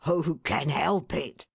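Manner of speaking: lightly voiced fond husband; a plain man's hearty question; a hint of a cheerful father; Mister Tetterby. A narrator's reading. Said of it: "cried Mister Tetterby".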